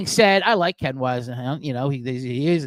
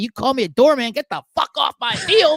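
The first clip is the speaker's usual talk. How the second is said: mock furious coach, pitched high and loud; retold angry yell; 10 semitones higher, 4 dB louder than usual. Podcaster quoting a riled-up coach, higher and louder mock yell. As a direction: belt it out like a coach raging at the other sideline. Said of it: "When they're saying".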